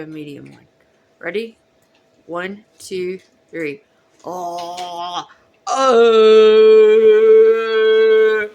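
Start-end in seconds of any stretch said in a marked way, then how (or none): 4.2-8.5 extremely deep bass voice. Shown as a demonstration. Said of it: "the deepest, the lowest voice I can go".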